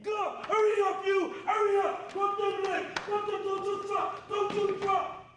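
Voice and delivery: barked orders at full volume; drill sergeant shouting. Real, not acted. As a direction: yell it like a drill instructor. Drill instructor rushing recruit.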